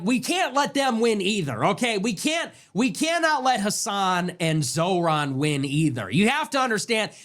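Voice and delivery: ordinary talk, nothing marked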